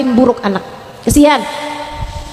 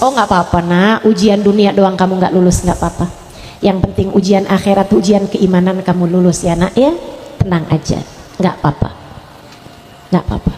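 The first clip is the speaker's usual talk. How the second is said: soothing mother; 7 semitones lower than usual. Acted. Mother to child who failed an exam, calm reassurance. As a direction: play a mother gently cooling a child's worry. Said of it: "Adem nih punya emak kayak begini nih".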